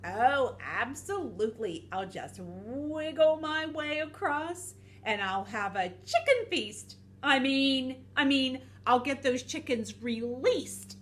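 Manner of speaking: eager, slippery storybook villain; scheming snake, falsely helpful. Acted. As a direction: let the greedy excitement slip, then cover it.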